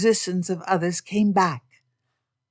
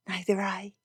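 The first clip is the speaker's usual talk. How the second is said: soft breathy reply; 2 semitones higher, 5 dB quieter than usual. Acted. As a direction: let it come out on the breath. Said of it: "she replied in a breathy voice".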